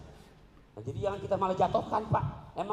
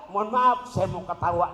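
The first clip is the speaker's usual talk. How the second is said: ordinary talk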